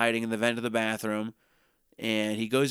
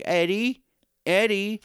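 high, louder mock call of a name; 9 semitones higher, 4 dB louder than usual. Girlfriend calling out to her boyfriend, mock high call.

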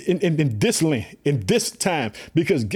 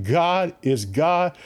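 slow emphasis; much slower than usual. Real, not acted. Preacher's emphatic declaration.

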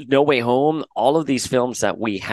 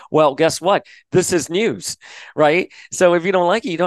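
sassy retort; 7 semitones higher, 3 dB louder than usual. Retold or imitated. Retold retort.

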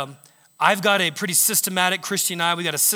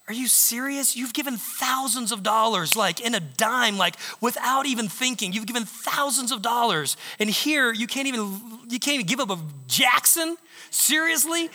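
higher, incredulous scolding wife voice; wife's exasperated thoughts; 5 semitones higher than usual. Retold or imitated. Wife silently scolding husband over the twenty, incredulous. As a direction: play the wife's disbelief, pitched up. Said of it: "she's thinking".

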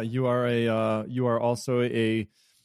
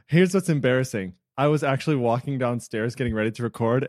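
usual speaking voice

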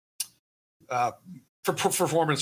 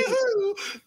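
cheer. Real, not acted. Cheering Han Solo rescue.

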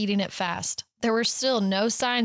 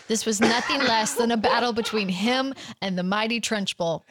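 ordinary talk

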